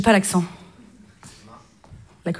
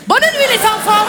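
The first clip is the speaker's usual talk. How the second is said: put-on brisk, raised German-accented good night; hammed-up German accent; German-accented babysitter; 3 semitones higher than usual. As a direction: dismiss the children in a German accent. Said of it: "Humour germanique".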